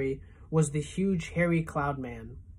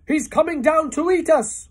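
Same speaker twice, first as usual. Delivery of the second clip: much higher and louder, a terrified wail; 11 semitones higher, 9 dB louder than usual. A narrator's reading. Old grasshopper wails in terror at the cloudman, loud and high. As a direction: wail it out in alarm. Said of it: "wailed the old green grasshopper".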